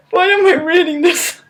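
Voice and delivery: very high laugh into disbelief; exasperated laugh. Real, not acted. Reader laughing at her own reading, very high. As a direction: crack up and question it all.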